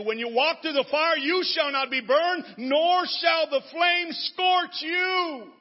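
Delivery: high, loud and slowing proclamation; preaching at full force. Acted. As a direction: let the verse swell and stretch. Scripture climax, building.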